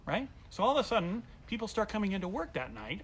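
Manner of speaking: ordinary talk, nothing marked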